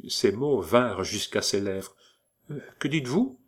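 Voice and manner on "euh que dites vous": reproachful. A narrator's reading. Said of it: "demanda Saphir avec reproche".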